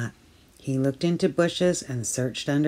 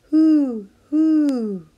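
very high, loud hooting owl; 13 semitones higher, 9 dB louder than usual. Acted. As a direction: hoot like an owl in the dark.

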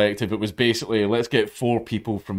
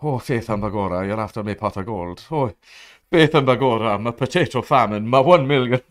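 a hint of a mock Irish brogue; lightly hammed-up Irish leprechaun voice; 3 semitones higher, 4 dB louder than usual. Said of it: "little leprechaun who's got".

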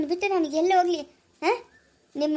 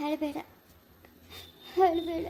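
hushed, much softer don't-cry; soft comforting; 6 dB quieter than usual. Acted. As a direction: gently soothe someone who's crying. Comforting a crying parent.

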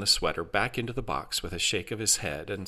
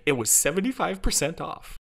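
high, delighted announcement; upbeat AI helper, pitched high; cheerful exclamation; 9 semitones higher than usual. A narrator's reading. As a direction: deliver it as wonderful news. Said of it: "Jarvis exclaimed".

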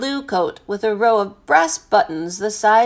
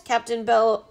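plain reading voice

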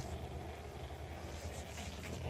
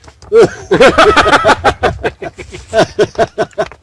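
high burst of laughter; loud, high laughing fit; 10 semitones higher, 12 dB louder than usual. Real, not acted. Joke about a boar waiter.

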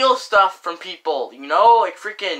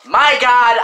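usual speaking voice

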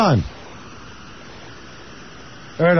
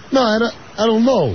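Kurt voice; 4 semitones higher than usual. Retold or imitated. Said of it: "I says like this".

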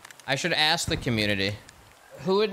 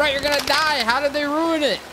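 insistent loud; 10 semitones higher, 5 dB louder than usual. Real, not acted. Pressing the question loudly.

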